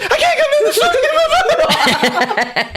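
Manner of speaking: very high, hysterical mock scream; panicked screaming. Guard answering his companion, high hammed-up screaming. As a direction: squeal back in comic panic. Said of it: "is still screaming".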